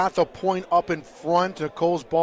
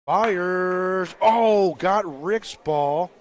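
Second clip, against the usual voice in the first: loud, excited shot call; 3 semitones higher, 6 dB louder, much slower than usual. Real, not acted. Commentator calling Rick's ball getting hit, excited.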